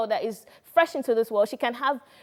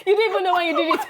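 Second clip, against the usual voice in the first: laughing; 8 semitones higher, 6 dB louder, faster than usual. Real, not acted. Laughing at the shared imitation.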